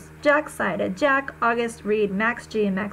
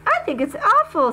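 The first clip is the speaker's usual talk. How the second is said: put-on higher, louder girl's voice in protest; Summer objecting; 10 semitones higher, 5 dB louder than usual. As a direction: protest it, upset on his behalf. Girl objects to the plague joke.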